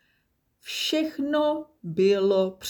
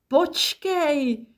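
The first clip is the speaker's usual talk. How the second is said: much higher, falling yelp of wait; chimp's alarmed cry; 9 semitones higher, 4 dB louder than usual. A narrator's reading. Chimp calling after Nimble, high and alarmed. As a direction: squeal it out as the chimp.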